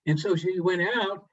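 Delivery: usual speaking voice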